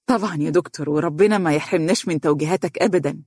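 ordinary delivery, nothing marked

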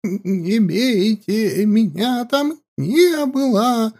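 high, slow sobbing plea; sobbing mutter. Acted. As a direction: whimper it through tears. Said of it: "схлипывая, бормотала она".

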